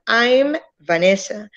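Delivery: I'm Vanessa, slow, with the lips closing on the M of I'm; slow I'm Vanessa with a clear M; clear M sound. Shown as a demonstration. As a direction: press the lips together for the M in I'm. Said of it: "La M tiene que sonar".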